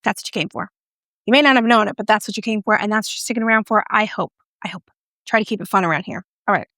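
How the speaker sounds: ordinary delivery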